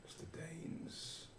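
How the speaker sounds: slow unvoiced whisper. NPC repeating the stranger's name, slow whisper. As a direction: breathe the name out slowly.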